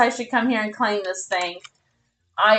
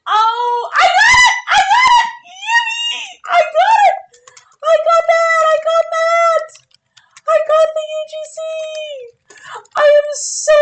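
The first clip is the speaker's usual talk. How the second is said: high, loud, squealing with joy; overjoyed screaming, laughing and near tears; ecstatic squealing; 5 dB louder than usual. Real, not acted. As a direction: shriek with real delight at the win. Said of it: "I'm overreacting".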